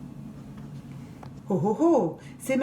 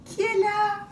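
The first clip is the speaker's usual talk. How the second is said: put-on girls' voice; 13 semitones higher than usual. Girls ask behind door.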